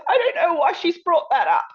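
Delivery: high, loud mock wail; distraught protest. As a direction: imitate an upset woman protesting at the top of her range. Retold upset woman protesting.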